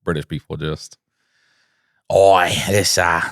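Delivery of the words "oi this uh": a quick mock Australian "Oi"; Australian accent. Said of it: "well, that was Australian".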